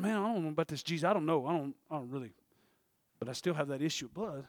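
low, soft acted hesitation; doubtful self-talk. Preacher voicing the sick woman's thoughts, doubtful self-talk. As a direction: mutter it low as her private doubts.

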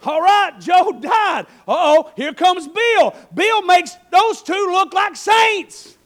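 loud, high, fiery sermon voice; full-force preaching. Acted. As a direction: preach it out to the back row.

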